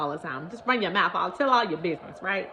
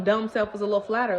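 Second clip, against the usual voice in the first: ordinary delivery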